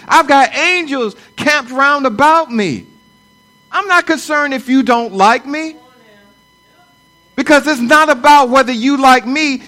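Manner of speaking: rising, loud, declaring with fire; full-force preaching. Acted. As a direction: preach it with conviction and build.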